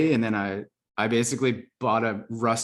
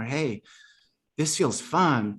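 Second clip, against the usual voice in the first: heart's hustler voice; 5 semitones higher, slower than usual. Retold or imitated. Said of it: "a weird criminal-esque accent".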